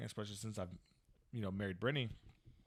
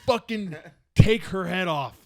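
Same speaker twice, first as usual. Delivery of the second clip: loud, slow, pitched-up order; mock aggressive shout; 8 semitones higher, 18 dB louder, slower than usual. Parent coaching from the sideline, comically fierce shout. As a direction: bellow it like a rabid sports parent.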